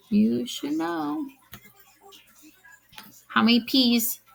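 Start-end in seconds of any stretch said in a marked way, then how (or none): 0.1-1.3 You should know slowly drawn out, ending on a stretched "know". Shown as a demonstration.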